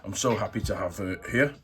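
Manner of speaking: normal talking voice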